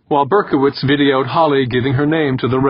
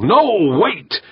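high, urgent yell, moderately loud; 10 semitones higher, 3 dB louder than usual. A narrator's reading. Director stopping a candidate from the stage, yelling. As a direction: call out to halt them. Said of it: "Berkowitz yelled from the stage".

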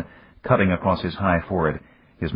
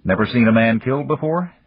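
pointed question, pitched up; harsh, bitter; 6 semitones higher, 4 dB louder than usual. A narrator's reading. Man challenges a shaken woman after a killing, harsh and bitter. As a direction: throw it at her with understated bitterness. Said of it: "Dan's voice sounded harsh, bitter".

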